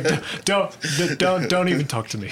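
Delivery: a hint of a stern South African coach; South African accent. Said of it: "thick South African accent".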